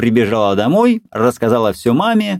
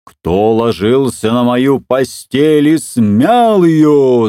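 slow, louder papa bear roar; frightening roar; 4 semitones higher, 4 dB louder, slower than usual. A narrator's reading. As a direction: bellow it like a huge bear. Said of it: "заревел страшным голосом". (страшный рёв медведя)

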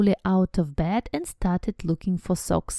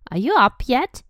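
sharp demanding question, higher and louder; 5 semitones higher, 7 dB louder than usual. A narrator's reading. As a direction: bark the question at him through the door. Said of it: "she demanded".

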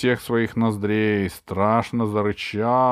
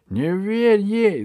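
high, loud, drawn-out witch cry; shouting witch; 11 semitones higher, 5 dB louder, much slower than usual. A narrator's reading. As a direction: warn the dragon in a high screech. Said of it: "закричала ведьма". (крик ведьмы высоким голосом)

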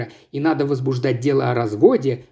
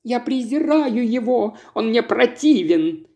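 a touch of high scorn; scornful wife; 6 semitones higher than usual. Acted. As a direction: spit it out with some disgust. Wife denouncing Jordan.

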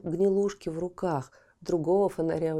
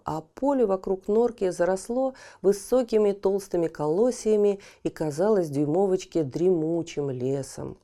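usual speaking voice

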